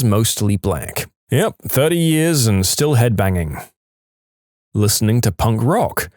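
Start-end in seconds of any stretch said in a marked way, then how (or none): none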